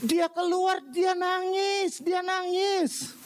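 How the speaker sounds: high-pitched, insistent sermon delivery; impassioned preaching. Real, not acted. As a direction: drive the point home from the pulpit. (khotbah berapi-api)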